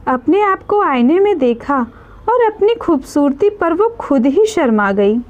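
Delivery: plain reading voice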